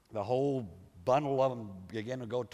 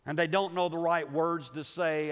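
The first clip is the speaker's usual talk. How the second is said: normal talking voice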